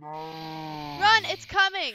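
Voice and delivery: panicked screaming. Real, not acted. Sign text shouted.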